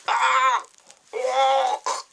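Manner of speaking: high, loud startled "Ah"; choked cry. A narrator's reading. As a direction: gasp it out in shock. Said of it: "choking sounds".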